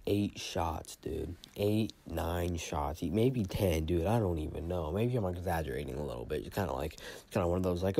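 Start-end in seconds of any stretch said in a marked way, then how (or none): none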